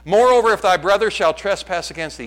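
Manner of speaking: loud, lofty scripture tone for a modern twist; mock scripture reading. Shown as a demonstration. Pastor parodying scripture for the congregation, mock solemn. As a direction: read it like holy writ, then twist it. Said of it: "here's what we do in the twenty-first century".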